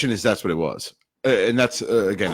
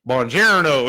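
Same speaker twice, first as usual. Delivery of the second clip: slow, drawn-out Italian Buongiorno, a bit louder; mock Italian greeting; 4 semitones higher, 5 dB louder, slower than usual. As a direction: stretch out Buongiorno like a clueless tourist. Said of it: "he's like".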